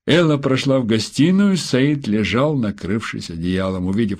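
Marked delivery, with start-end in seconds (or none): none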